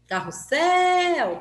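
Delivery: SSEL in "Carrossel" very long, high and loud; "Carrossel" hollered with a stretched ending; calling out, final syllable prolonged. Shown as a demonstration. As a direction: call "Carrossel" like a name, holding the last syllable. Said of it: "Quem que eu falei mais?".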